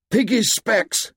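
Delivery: high, slow urgent shout. A narrator's reading. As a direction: call it back down the hill. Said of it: "shouted Ralph".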